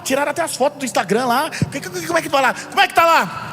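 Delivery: pry for details breathlessly; nosy prying. Pretend. Gossip pries about the woman's troubles, fast and eager.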